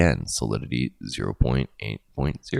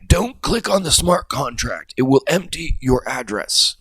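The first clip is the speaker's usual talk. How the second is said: panicked crowd warning; 10 semitones higher than usual. Retold or imitated. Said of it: "people are going".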